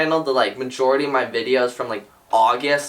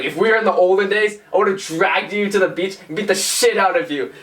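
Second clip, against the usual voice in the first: raised, menacing voice; gruff threat; 4 semitones higher than usual. Retold or imitated. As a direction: act out the threatening guy. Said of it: "and he's like".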